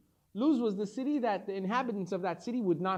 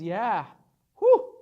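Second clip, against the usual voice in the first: relieved whew; 11 dB louder than usual. Acted. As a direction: let out a big happy whew. Angel all happy and jiggly.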